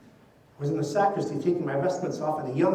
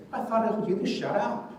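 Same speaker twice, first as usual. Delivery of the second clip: put-on pitched-up, exasperated parent snapping at a child; irritated adult scolding; 7 semitones higher than usual. Adult scolding a noisy toddler, mock irritated. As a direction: mimic a fed-up grown-up scolding a kid. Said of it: "And someone said".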